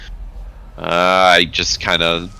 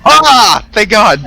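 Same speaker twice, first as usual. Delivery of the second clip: relieved cry; 8 dB louder, slower than usual. Real, not acted. Relieved cry at passing roll.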